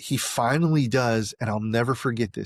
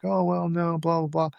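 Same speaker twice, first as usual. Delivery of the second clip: pitched-up brushing it off; high, airy shrug of a reply; mock dismissive brush-off; 6 semitones higher than usual. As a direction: wave it away lightly. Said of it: "and I was like".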